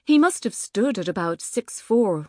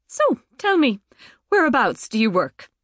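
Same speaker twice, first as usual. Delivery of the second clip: bright polite question, higher; 5 semitones higher, 4 dB louder than usual. Acted. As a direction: ask nicely, eager to please. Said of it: "she asked politely".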